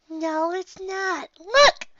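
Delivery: put-on high, childlike, drawn-out voice, loud on the last word; young pony reassuring. A narrator's reading. Pony showing a scared friend the snow, eager reassurance. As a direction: coax like an eager little kid. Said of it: "I tell her".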